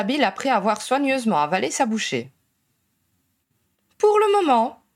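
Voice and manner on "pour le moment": high, lofty royal decree; imperious. A narrator's reading. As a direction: grant it like a queen. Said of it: "convint Ginny impériale".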